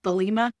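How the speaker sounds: "Thelema" as thuh-LEE-muh, stressed on the middle syllable; corrected pronunciation. Shown as a demonstration. Host correcting himself for listeners, "Thelema" pronounced carefully as thuh-LEE-muh. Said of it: "I think is how it's pronounced".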